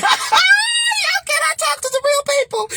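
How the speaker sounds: very high, loud laughing call to the audience; laughing shout. Real, not acted. Laughing loudly at her own risqué joke to the viewers.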